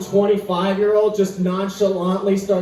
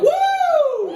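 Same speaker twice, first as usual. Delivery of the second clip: whoop. Real, not acted. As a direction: cheer like you just won. Celebrating alarm fixed.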